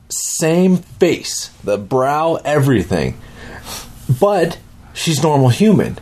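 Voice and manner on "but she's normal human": ordinary talk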